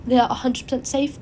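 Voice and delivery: ordinary talk, nothing marked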